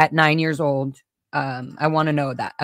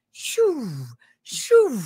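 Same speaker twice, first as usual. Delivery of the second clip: mock whooshing shoo; 7 semitones higher than usual. Money explained over her head.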